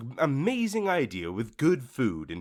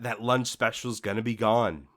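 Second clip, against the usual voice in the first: ordinary talk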